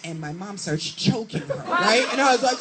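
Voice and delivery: normal talking voice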